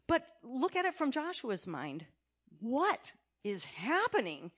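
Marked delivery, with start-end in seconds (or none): none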